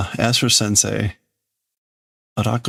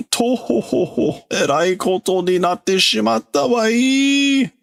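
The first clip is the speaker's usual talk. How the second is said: put-on high wailing old man, voice pitched way up; crying old professor; 12 semitones higher than usual. Old professor wails to the class about the disaster, high and tearful. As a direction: whimper it out like a fretful old professor. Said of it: "So he's kinda crying and saying".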